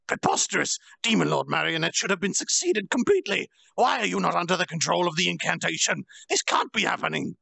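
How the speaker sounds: high, loud, panicked outburst; flustered villain losing control; bewildered blurting. Acted. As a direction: blurt it out in shock. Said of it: "he blurted out, bewildered".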